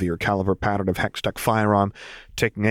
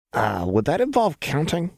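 bored groan; 7 semitones higher than usual. A narrator's reading. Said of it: "Jinx groaned as she sagged dramatically".

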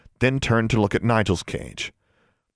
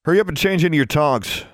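brisk command, pitched up; ordering; 7 semitones higher than usual. A narrator's reading. Senior student sending two girls to change, ordering. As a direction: give it as an order. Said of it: "Maximilian ordered".

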